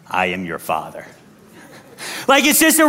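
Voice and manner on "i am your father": very deep, soft pronouncement; mock-godlike father. Dad addressing his kids, mock-godlike deep voice. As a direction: sink to the bottom of the voice, solemnly. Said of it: "every time I talk to my kids now".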